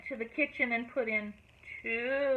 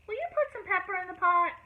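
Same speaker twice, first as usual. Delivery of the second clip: calling out; 7 semitones higher, 6 dB louder than usual. A narrator's reading. Calling to Joe outside.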